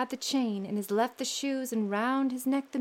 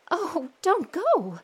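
higher, slower pleading cry; frightened cry; 8 semitones higher, 5 dB louder, much slower than usual. Acted. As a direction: beg him not to go, afraid. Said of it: "cried the wife".

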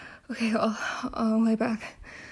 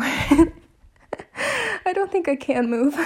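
laughing; 5 semitones higher, 3 dB louder, much slower than usual. Real, not acted. Laughing, exhausted.

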